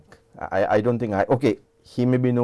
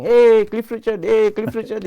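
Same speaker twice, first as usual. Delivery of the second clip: shouting; 13 semitones higher, 9 dB louder than usual. Retold or imitated. Fellow hailing the singer in the street, shouting. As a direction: imitate a fellow calling out down the lane. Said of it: "some fellow started shouting".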